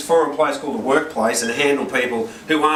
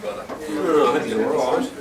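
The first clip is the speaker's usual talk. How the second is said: slow, far-off cooing of a worried mother; mock sympathetic mum; slower than usual. Wife fussing over the sad son, slow mock cooing. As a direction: coo over the child, slowly, like a fretting mum. Wife's sympathetic reply retold.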